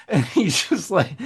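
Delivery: normal talking voice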